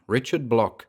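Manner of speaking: normal talking voice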